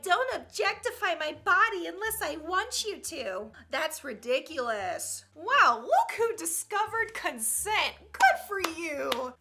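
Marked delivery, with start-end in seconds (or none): 0.0-3.5 higher, put-on woman's complaint; mock woman's voice. Mocking what women be like, put-on higher voice. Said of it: "Women be like". 5.4-9.3 slow, loud mock amazement; sarcastic praise. Congratulating someone on discovering consent, sarcastically slow.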